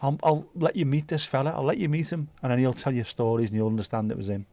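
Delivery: normal talking voice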